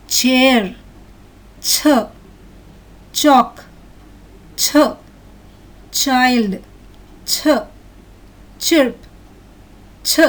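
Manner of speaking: each word followed by an isolated "chuh" for "ch". Shown as a demonstration. Teacher has children listen and repeat, spaced-out ch drill. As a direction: drill "Chair", "ch", "Chalk", "ch", with long pauses. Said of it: "Ch and k pronunciation of C H syllables".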